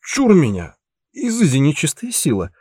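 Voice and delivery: tipsy mock exorcism cry; drunk with hiccups. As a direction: drunkenly wave off the clone. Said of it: "пьяно икнул".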